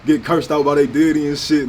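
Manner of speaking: ordinary talk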